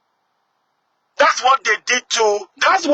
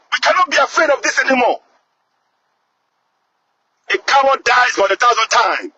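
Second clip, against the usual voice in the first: ordinary talk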